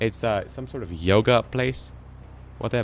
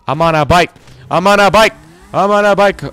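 loud, high-pitched repeated chant; gleeful bragging; 8 semitones higher, 11 dB louder than usual. Real, not acted. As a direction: belt it out with rising excitement. Excited chant after getting a bike.

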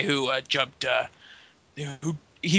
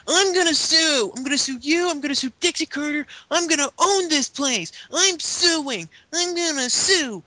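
whiny tantrum; 12 semitones higher than usual. Retold or imitated. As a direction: wail it like a sore loser. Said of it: "dives out of the ring and goes".

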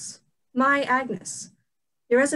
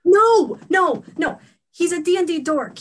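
first word loud and high, then easing; exasperated correction; 3 semitones higher, 6 dB louder than usual. Acted. Friend correcting the alarmed boyfriend, exasperated. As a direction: cut off the misunderstanding with rapid denials.

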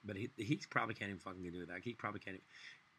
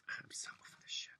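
whisper; 5 dB quieter than usual. Real, not acted. Whispered aside.

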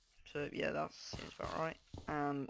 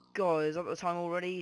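raised voice to viewers; loud call; 3 semitones higher, 8 dB louder than usual. Real, not acted. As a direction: call out to the guys.

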